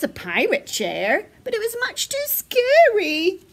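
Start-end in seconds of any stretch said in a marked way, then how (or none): 1.4-3.4 high, louder storyteller's alarm; mock scared. A narrator's reading. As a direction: play up the scare for children. Dramatising the scary chair.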